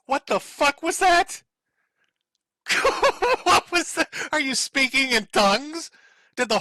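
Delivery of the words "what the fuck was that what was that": high, slow incredulous question; stunned disbelief. Real, not acted. Streamer reacting to the horse-noise clip, stunned and incredulous. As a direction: drag it out in utter disbelief.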